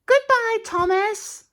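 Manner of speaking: very high sweet carriage voice calling out; Annie's voice. Acted. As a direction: call a cheery farewell in a high voice. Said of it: "there's Annie saying".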